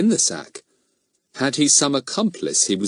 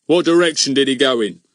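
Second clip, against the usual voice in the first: higher, louder bossy constable; eager policeman Goon; 5 semitones higher, 5 dB louder than usual. Acted. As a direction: demand it like a pompous village bobby. Goon's voice in the story.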